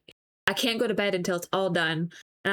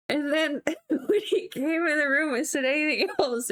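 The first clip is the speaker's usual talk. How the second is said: words broken by real giggles; higher pitch, laughing mid-sentence; 6 semitones higher than usual. Real, not acted. Wife recalling husband coming into the room, laughing. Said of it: "I just kept laughing about it".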